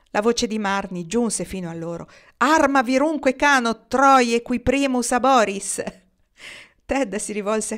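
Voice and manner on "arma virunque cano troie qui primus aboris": high, loud Latin declamation; grand, projected recitation; declaiming verse. A narrator's reading. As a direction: ring it out like an epic poem. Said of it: "in questo momento sta declamando".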